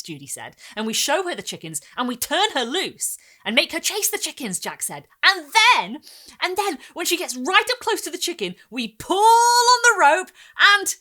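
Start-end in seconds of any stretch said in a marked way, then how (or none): 0.7-4.6 put-on high, loud eager boy; excited boy. A narrator's reading. Boy insisting on telling it, high and loud. 5.2-11.0 higher and louder, stretched rope; building excitement. A narrator's reading. Boy building the story to its climax, very loud.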